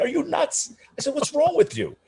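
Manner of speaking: the shocked "you did what?" squeak; incredulous scolding. Retold or imitated. Narrator scolding his cousin for lending money, high and incredulous.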